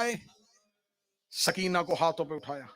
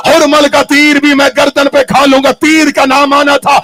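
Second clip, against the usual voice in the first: louder, higher wailing recitation of grief; rising majlis lament; 5 semitones higher, 19 dB louder than usual. Acted. Preacher mourning Sughra before the congregation, loud wailing. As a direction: build the lament to a full cry.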